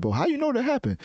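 high-pitched doubting mimic; high mock-incredulous question; mock challenge. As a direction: imitate a sceptic, pitch jumping way up. Voicing sceptical people challenging him.

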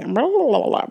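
quick babbled rewind sound; imitated rewind noise. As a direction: babble like a tape spooling back.

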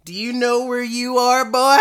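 high, loud put-on voice; hammed-up challenge to a stranger; mock-incredulous, pushed up high and loud; exaggerated character voice. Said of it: "he doesn't sound like that".

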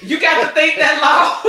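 loud, high, drawn-out laughing jab; laughing tease. Real, not acted. Host teasing co-host for hesitating, laughing shout. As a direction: shout it slowly through laughter.

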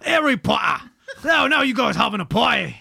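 loud, high, hammed-up English accent; bad English accent. Shown as a demonstration. Retrying the neighbour's party line, bad English accent. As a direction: overdo a shoddy English accent, pitched high. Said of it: "can't do an English accent".

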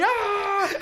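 high, laughing mock scream like Tom the cat; a hammed-up cartoon howl; cartoon pain yelp. Friend says he sounds like a cartoon cat, high mock yelp. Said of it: "You sound like Tom from fucking Tommy Jerry".

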